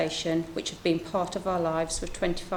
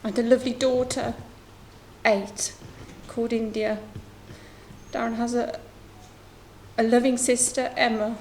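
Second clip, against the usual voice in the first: holding it together through grief; voice breaking; 5 semitones higher than usual. Real, not acted. Grieving mother reads family statement.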